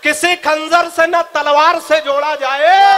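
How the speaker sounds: slow, loud, declaiming a couplet; poetic recitation. Shown as a demonstration. Comic poet declaims his couplet to the audience, slow and loud. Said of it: "सुनिएगा".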